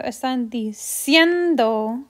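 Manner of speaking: "diciendo" with a long, strong "cien"; stress on CIEN. Shown as a demonstration. Teacher showing the natural accent, slow stress on "cien" in "diciendo". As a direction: slowly lean on "cien" in "diciendo". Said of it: "Our accent naturally goes here on cien".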